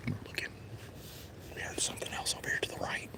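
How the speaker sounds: hushed whisper listening for deer; barely voiced whisper. Real, not acted. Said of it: "That's why I'm talking low".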